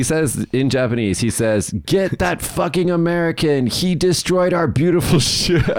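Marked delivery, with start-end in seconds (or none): 1.8-5.7 high, hammed-up imitation of a yelling man; mock scream. Friend rouses old men against the American, mock scream. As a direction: imitate a frantic accuser crying out, without full volume. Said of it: "He starts screaming at the top of his lungs".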